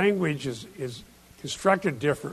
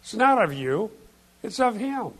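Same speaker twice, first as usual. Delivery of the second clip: "not" rising, "of" high and falling; "It's not of you. It's of him." with stress on not, then on of; contrastive stress; 6 semitones higher than usual. Shown as a demonstration. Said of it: "It's emphatic".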